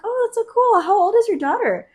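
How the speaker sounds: way up high, sugary, put-on cheerful; fake sweet interest. Asking the older coworker about his daughter, overly sweet and high. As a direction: chirp it like you're faking delight.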